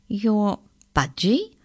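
slow, drawn-out disbelieving question; incredulous. A narrator's reading. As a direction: query it slowly, as if you can't believe it.

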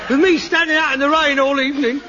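half-laughing shouted blame; angry accusation. Acted. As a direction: yell the grievance at him. Son accuses father in a row.

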